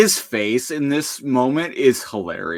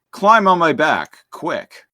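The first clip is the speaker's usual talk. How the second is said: urgent command; 8 semitones higher, 5 dB louder than usual. Retold or imitated. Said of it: "he's like".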